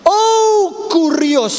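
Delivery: high, loud cry of Kurios; exclaiming Kurios. Acted. Preacher to congregation, crying out Kurios high. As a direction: cry out the name in awe.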